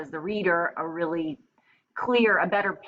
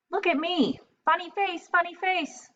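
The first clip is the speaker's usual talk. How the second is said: much higher sing-song funny face; silly taunt; 13 semitones higher, 3 dB louder than usual. Acted. Napoleon pulls funny faces for attention, high sing-song. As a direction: sing it out goofily.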